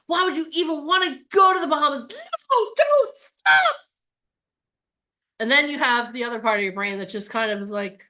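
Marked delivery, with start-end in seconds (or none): 0.1-3.7 rising to a high, loud mock crying shout; hammed-up fearful shriek, pitch climbing; panicked mock cry. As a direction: panic and plead, building to a wail. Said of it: "that fear comes in".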